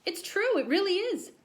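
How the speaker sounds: put-on high childlike insistence; insistent boy. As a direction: plead it like a kid.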